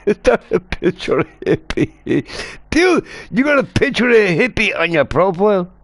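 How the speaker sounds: high, laughing, amused; laughing disbelief. Real, not acted. Spots friend's profile picture.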